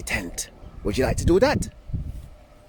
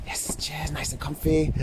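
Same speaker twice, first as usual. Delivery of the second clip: whispering; 3 semitones higher, 6 dB quieter than usual. Acted. Whispered aside on tent tour.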